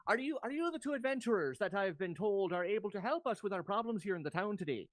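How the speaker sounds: lilting Irish town mayor; Irish accent. Acted. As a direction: appeal for help in an Irish lilt. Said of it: "You're staying with the Irish".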